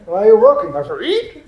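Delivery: loud, high-pitched parody of a dutiful German; mock German worker. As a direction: bark out the question and answer, hammed up. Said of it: "Germans, they walk. When you ask them".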